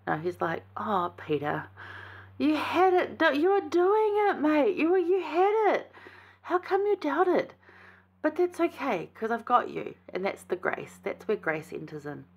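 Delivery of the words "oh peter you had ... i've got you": a lightly voiced Jesus comforting his friend; Jesus gently reassuring Peter, higher and kind; gentle Jesus. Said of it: "he's not doing it in a, like, a horrible way".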